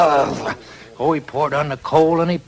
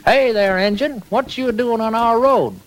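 put-on boy Orley; 9 semitones higher than usual. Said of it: "Orley, he says".

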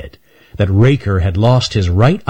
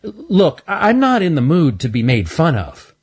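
said sharply; 9 semitones higher than usual. Acted. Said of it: "said Stanley sharply".

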